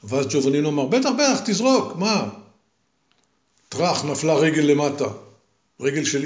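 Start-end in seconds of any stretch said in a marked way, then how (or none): 0.9-2.3 high, eager and cheerful. Acted. Fearless young man cheerfully urging the voice on. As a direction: egg the voice on, unafraid.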